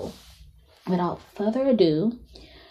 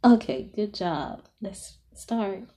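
softer, half-whispered breathing cues; guided meditation; 9 semitones higher, 12 dB quieter than usual. Acted.

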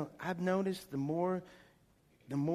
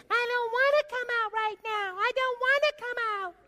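high, feminine little demon voice; English imp voice; 6 dB louder than usual. Retold or imitated. Demon refusing to leave, in a high English imp voice. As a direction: whine like a small English imp. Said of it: "Little English kinda imp, feminine kinda".

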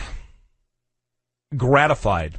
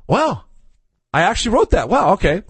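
higher, wondering at his own words; mock amazement; 6 semitones higher than usual. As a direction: marvel at your old letter, lightly. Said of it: "you read through it and you say".

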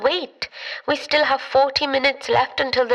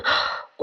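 sharp gasp; 3 dB quieter than usual. Acted. Realises the opportunity.

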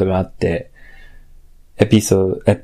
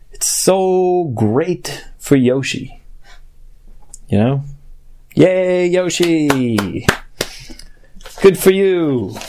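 fake cheer; 6 semitones higher than usual. Forced cheer after grumbling.

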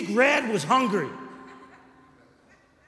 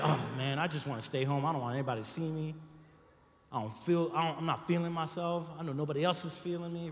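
low, soft, moping self-talk; mock gloom; 4 semitones lower, 6 dB quieter, faster than usual. Congregation member moping about staying home, softly sulking. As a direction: sulk it out, softer and lower.

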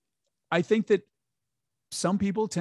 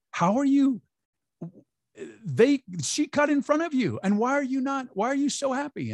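much higher, baffled busybody voice; mocking the woman; 11 semitones higher than usual. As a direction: squeak it out like an incredulous stranger. Voicing the grumpy coffee-shop woman.

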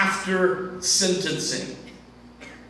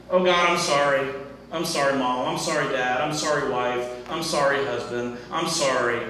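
mock repetitive sorry; 7 semitones lower than usual. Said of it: "when we walk around saying".

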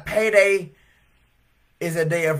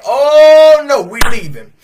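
loud, high and panicked; alarmed cry; 8 semitones higher, 13 dB louder, slower than usual. Retold or imitated. Customers crying out and leaving the store, alarmed. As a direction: flee the store in a hurry.

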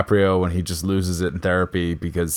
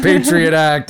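Patriot act barked higher and louder; loud yell; 6 semitones higher, 5 dB louder, slower than usual. Retold or imitated. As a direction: shout Patriot act as a tough cop. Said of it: "He's like".

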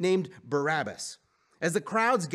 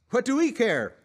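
higher, louder dismissive retort; sharp retort; 9 semitones higher, 5 dB louder than usual. A narrator's reading. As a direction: throw it back at him. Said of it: "they retorted".

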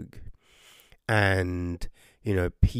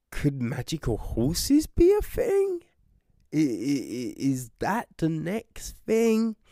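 much higher, playfully incredulous; mock wonder; 5 semitones higher than usual. Reviewer joking about the plot escalating, higher mock wonder. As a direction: ask it wide-eyed, half joking.

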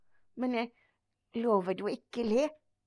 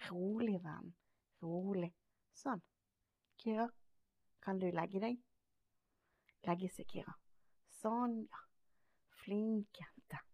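calm quiet commands; 2 semitones lower, 9 dB quieter than usual. Real, not acted. Calming the dog to lie down.

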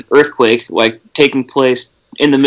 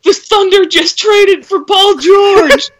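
much higher, louder, slow excited yell; 3 dB louder, slower than usual. Retold or imitated. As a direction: shout the trade news like you can't believe it. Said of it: "came outside and yelled".

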